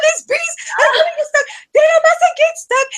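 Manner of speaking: high, loud mock panic; panicked shriek. Mother-in-law wheeling her husband away, high panicked shrieking. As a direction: squeal it like someone fleeing bees. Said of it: "she's, like, wheeling him around".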